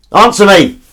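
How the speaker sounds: loud, high demand for an answer; mock demanding shout. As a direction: bellow it in pretend impatience.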